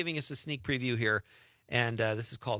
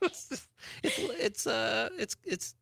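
high, laughing through the words; can't stop laughing while talking; 9 semitones higher than usual. Real, not acted. Laughing at her accents.

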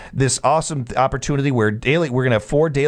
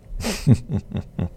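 chuckle; 4 dB quieter than usual. Real, not acted. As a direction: chuckle to yourself after a joke. Chuckles after his own joke.

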